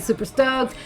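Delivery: ordinary talk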